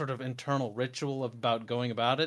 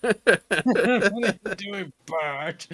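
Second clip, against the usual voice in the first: slow teasing through laughter; laughing; 5 semitones higher, 4 dB louder, much slower than usual. Pretend. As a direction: drag it out while laughing.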